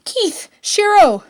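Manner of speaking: high, eager calling of names; excited call. A narrator's reading. Lance spotting his mates on screen, excited call. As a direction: call out to them, overjoyed.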